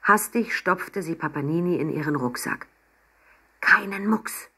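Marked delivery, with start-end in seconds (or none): none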